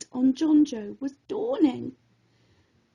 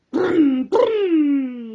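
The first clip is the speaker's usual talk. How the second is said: loud mock motorbike revving; playful loud engine noise; engine brum; 5 dB louder than usual. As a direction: rev it like a bike.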